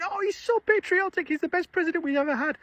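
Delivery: sing-song mockery of an adoring fan; mocking gushing fan. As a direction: parody a starry-eyed supporter gushing over the president. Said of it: "And everyone's going".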